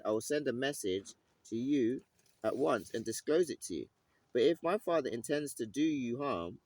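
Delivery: lightly dramatised scripture reading. Acted. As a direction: read the passage out with weight. Reads the passage aloud.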